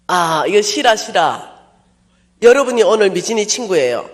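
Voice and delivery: normal talking voice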